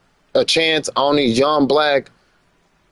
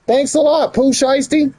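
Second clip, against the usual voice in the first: much higher, mocking thank-you; sarcastic thanks; 12 semitones higher than usual. Real, not acted. Vlogger sarcastically thanking [name], high mocking voice. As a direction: thank him with a sneer, pitched way up.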